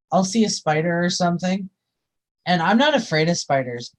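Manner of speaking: ordinary talk, nothing marked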